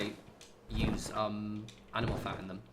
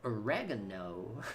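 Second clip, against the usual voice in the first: slow, softer American "Oregano", o-REG-ano; mock American accent; 5 dB quieter, slower than usual. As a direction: stretch "Oregano" American-style, stressed on the second syllable. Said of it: "It's oregano, mate".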